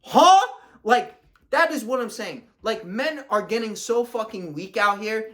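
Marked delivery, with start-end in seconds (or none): 0.0-0.5 high, loud baffled huh; sudden high-pitched disbelief; retold surprise. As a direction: react as if you cannot believe it. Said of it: "I was like".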